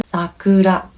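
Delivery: sakura with a light tapped r in the last syllable; Japanese sakura. Shown as a demonstration. Teacher showing learners Japanese sakura. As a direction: flap the r in sakura toward an l. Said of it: "Or another one".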